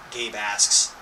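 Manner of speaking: usual speaking voice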